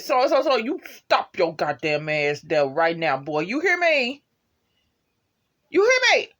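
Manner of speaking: put-on a mother reprimanding her boy, voice climbing; motherly authority. Retelling Watson scolding Connor, rising motherly yell. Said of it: "you can hear that authority come out of her voice".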